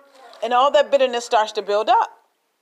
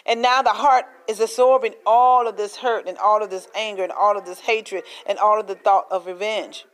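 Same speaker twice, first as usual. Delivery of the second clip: ordinary delivery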